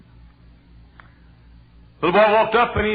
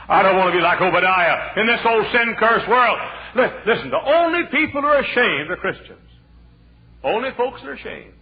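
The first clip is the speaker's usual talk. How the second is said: loud, high, rising sermon cry; fiery preaching; 11 semitones higher, 8 dB louder than usual. Acted. Preacher booming the story of Obadiah to the church. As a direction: preach it out to the congregation at full force.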